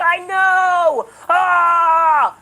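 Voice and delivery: high, loud put-on yell; mock outburst. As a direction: ham up a fake angry shout. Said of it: "And Seth was like".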